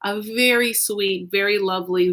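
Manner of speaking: ordinary talk, nothing marked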